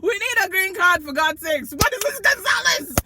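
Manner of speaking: loud, exasperated mock dad; shouting dad. Dad ranting at his daughter about Gonzalez, mock shout. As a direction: bellow it like a fed-up father.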